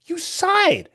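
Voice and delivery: high, loud accusing jab; high accusing disbelief. Retold or imitated. To the chatbot that sighed, in high disbelief. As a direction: call it out in disbelief. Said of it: "And I'm like".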